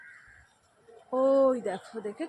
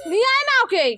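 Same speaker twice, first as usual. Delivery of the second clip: calling out loud; 10 semitones higher, 6 dB louder, faster than usual. Real, not acted. Calling to bring child.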